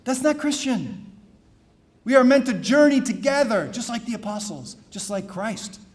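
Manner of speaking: rising preacher's exhortation, building loud; fervent homily build; preaching. Acted. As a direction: preach it out to the congregation. Preaching stations of the cross.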